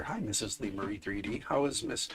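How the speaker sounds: ordinary delivery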